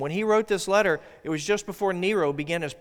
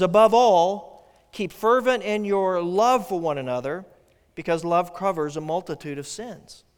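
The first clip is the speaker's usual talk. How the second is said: scripture reading; 2 semitones higher, 5 dB louder than usual. Acted. Pastor reads verse eight to the congregation, solemn. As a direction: read it out solemnly to the church.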